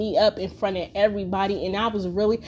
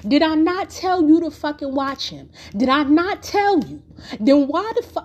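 raised, heated voice, a bit higher; angry scolding mom; 5 semitones higher, 3 dB louder than usual. Retold or imitated. Mother scolding her kid for not watching the boy, heated. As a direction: jab each word like a furious mother.

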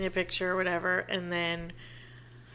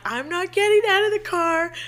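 high, louder mock protest; stubborn refusal; 10 semitones higher, 6 dB louder than usual. Girlfriend refusing boyfriend's request to get out, high loud protest. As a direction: dig in your heels, playfully loud.

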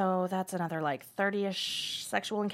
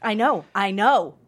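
louder exasperated "I know, I know"; frustrated outburst; 10 dB louder than usual. Acted. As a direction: blurt it out, fed up with yourself. Frustrated over the gonorrhea risk.

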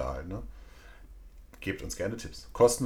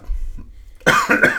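sudden loud coughing; coughing fit; 14 dB louder than usual. Real, not acted. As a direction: cough hard and rough. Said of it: "Jetzt muss ich leider husten".

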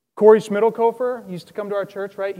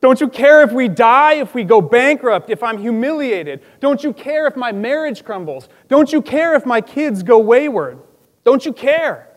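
high, loud, pleading cry; desperate yelling; 4 semitones higher, 7 dB louder than usual. Retold or imitated. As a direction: yell up at him from the dark valley. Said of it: "yelling out".

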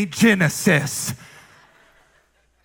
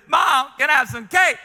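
put-on dramatic kid asking; 6 semitones higher than usual. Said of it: "he very dramatic".

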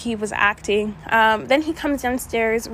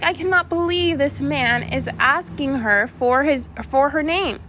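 incredulous, slightly raised pitch, stressed words; retold disbelief; 4 semitones higher than usual. Woman retelling her shock at his question, incredulous. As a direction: replay your own indignant disbelief.

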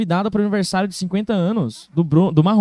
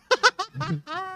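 soft, high put-on chuckle; small fake giggle, pitched way up; imitated little laugh; 14 semitones higher, 5 dB quieter than usual. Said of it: "ele dá uma risadinha bem assim".

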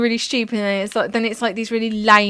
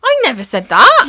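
high, loud protest; indignant denial; 7 semitones higher, 12 dB louder than usual. Real, not acted. To her co-host, loud high indignant denial. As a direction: act it out like it's an outrage.

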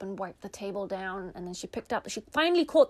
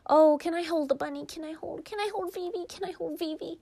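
put-on pleading little girl; 8 semitones higher, faster than usual. Sister begging to hold bunny.

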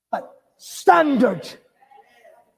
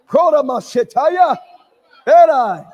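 speaking in tongues; 5 dB louder than usual. Real, not acted. Praying in tongues.

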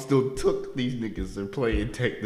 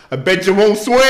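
loud taunting yell, higher; mock yell; 7 semitones higher, 9 dB louder than usual. Driver taunting workers from car, loud mock yell. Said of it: "you yell out".

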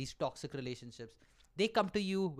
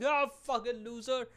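mocking best friend; 7 semitones higher than usual. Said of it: "He's laughing at you".

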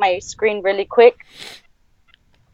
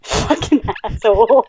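normal talking voice

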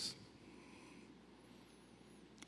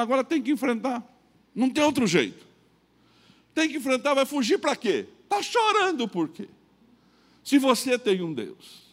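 much higher, urgent inner voice; self pep talk; 10 semitones higher, 4 dB louder than usual. Retold or imitated. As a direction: rouse yourself to face him.

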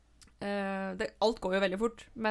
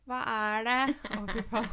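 called from upstairs; 3 semitones higher, 5 dB louder than usual. Retold or imitated. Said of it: "jeg hører fra andre etasje".

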